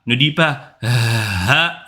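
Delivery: "ha" drawn out, slow and overdone; mock an overdone "ha"; exaggerated ha. Shown as a demonstration. Teacher warning against exaggerating ha, slow. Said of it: "n'exagère sur aucune lettre".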